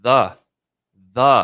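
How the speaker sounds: loud, isolated THE, pause after each; insistent THE. Real, not acted. Host prompting co-host on the tournament's name, insistent THE. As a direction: punch THE on its own and leave it hanging.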